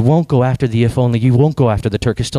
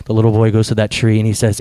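ordinary talk, nothing marked

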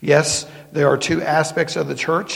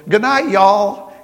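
slow, louder and higher southern drawl on "Good night, y'all"; southern accent; 6 semitones higher, 9 dB louder, slower than usual. Retold or imitated. Young woman from Maine says goodbye to pastor in Southern accent. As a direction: let a Southern goodbye slip out, drawn out. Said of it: "as soon as it came out of her mouth".

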